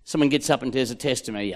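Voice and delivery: normal talking voice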